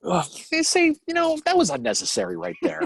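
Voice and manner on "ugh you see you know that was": high mock offended voice.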